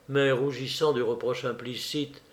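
ordinary delivery, nothing marked

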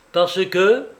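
curt challenge, rising at the end; almost harsh. A narrator's reading. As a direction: throw it back almost harshly. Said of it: "répondit presque durement Litvinov".

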